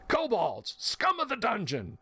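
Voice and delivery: high, drawn-out cry without real volume; mock battle cry. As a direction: cry out like a dwarf sighting foes, kept light. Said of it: "the dwarf cried out".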